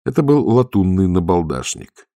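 usual speaking voice

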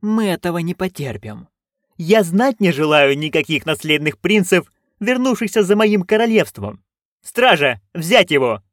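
high-pitched commanding noblewoman; imperious duchess, outraged. Acted. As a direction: issue orders as an indignant duchess. (властная, возмущённая герцогиня)

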